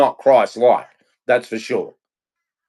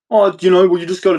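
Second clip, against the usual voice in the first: higher, louder whiny mimicry; mock excuse voice; 6 semitones higher, 4 dB louder, faster than usual. Mocking their excuses, high whiny voice.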